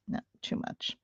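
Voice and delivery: breathy whisper to herself; hushed self-correction; whispered aside. Real, not acted. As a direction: whisper it, just to yourself. Whispered aside mid-story.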